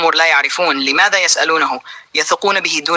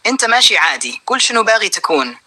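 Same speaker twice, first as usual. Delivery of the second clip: a touch of sharpness, pitch pushed higher; mildly sharp, pressing him to explain; sharp retort; 5 semitones higher than usual. A narrator's reading. A villager confronting him, mildly sharp and higher. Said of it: "قال له أحدهم بحدة".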